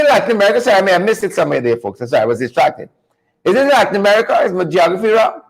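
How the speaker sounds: normal talking voice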